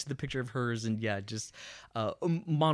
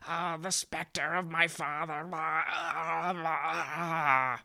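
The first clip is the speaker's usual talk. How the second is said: higher, hammed-up anguished moaning; mock writhing wail; 5 semitones higher, much slower than usual. Mocking Hamlet writhing on the floor, hammed-up wail. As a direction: wail it in overblown agony, dissolving into nonsense. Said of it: "he's just writhing around on the floor and like".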